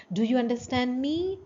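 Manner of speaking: rising question tune; teacher demo of a question intonation. Shown as a demonstration. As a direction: ask it with a lift. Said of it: "This is the tone".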